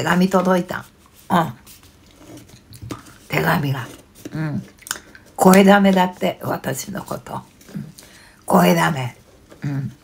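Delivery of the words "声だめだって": louder, indignant complaint. Real, not acted. Streamer complaining about the anti-fan letter, indignant and louder. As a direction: protest it with a raised voice.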